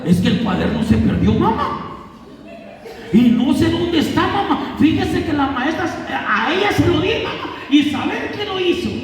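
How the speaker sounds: put-on high, pleading child's voice to mom; lying kid. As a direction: whine the excuse like a kid caught out. Niño mintiendo a su mamá. (niño mentiroso suplicando a su mamá, voz aguda)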